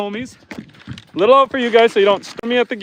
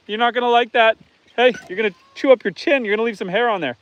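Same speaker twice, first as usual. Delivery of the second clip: usual speaking voice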